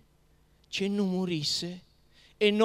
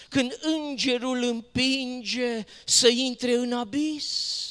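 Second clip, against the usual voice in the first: loud, ringing declamation; impassioned lament; 3 semitones higher, 5 dB louder than usual. Acted. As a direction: proclaim the grief with raised voice, line after line. Poem laments that nobody pities him.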